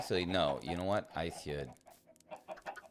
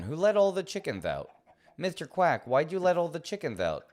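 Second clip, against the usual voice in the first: exasperated scolding; 7 semitones higher, 5 dB louder than usual. Real, not acted. Upset chickens escaped, scolding duck.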